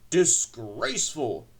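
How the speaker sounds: slow, loud pompous condemnation; outraged engine. A narrator's reading. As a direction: stretch the word with great indignation. Gordon condemns the rumour in outrage.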